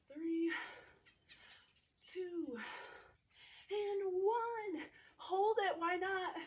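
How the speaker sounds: high, rising effortful count; strained countdown. Real, not acted. Strained countdown of last reps.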